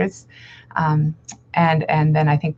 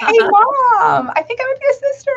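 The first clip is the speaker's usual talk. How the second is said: high, stilted fake-casual voice; stiff awkward speech; 5 dB louder than usual. Shown as a demonstration. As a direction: blurt it out, forcing casual. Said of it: "it doesn't come out well at all".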